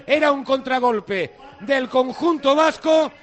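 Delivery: ordinary talk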